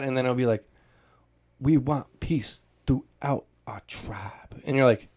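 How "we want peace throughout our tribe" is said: slow, halting fake accent; badly put-on accent, word by word; Mike Tyson. Mocking the movie actor's attempted accent, slow. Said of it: "he'll say it like that".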